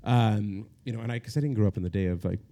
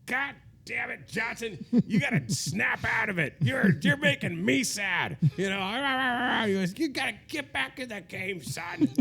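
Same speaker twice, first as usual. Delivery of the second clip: much higher, slower gruff pep-talk voice; friend's tough-love voice; 12 semitones higher, slower than usual. Retold or imitated. Older friend urging a sad man back into dating, in a high, slow mock gruff voice. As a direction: play the old friend telling him to snap out of it. Photographer friend scolding him in hotel.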